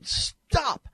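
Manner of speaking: high-pitched imitated shout, drawn into the word; shouted command. Stressing how the voice warned the driver, shouted. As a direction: cry it out sharply to halt a driver. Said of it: "I mean, shouting at him".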